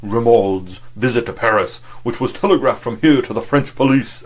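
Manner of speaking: loud, booming oratorical delivery; booming orator's bass. Acted. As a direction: fill a hall with it. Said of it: "boomed out in his great, clear, oratorical bass".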